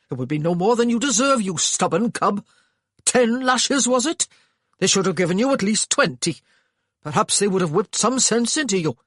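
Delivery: loud, high rebuke; angry scolding. A narrator's reading. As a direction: scold the stubborn cub. Brother scolding injured boy.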